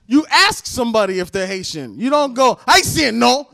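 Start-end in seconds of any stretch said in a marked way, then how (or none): 2.7-3.5 higher, louder blunt mock question; rude questioning. Shown as a demonstration. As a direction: demonstrate the rude way of asking. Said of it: "Don't be a rude piece of shit".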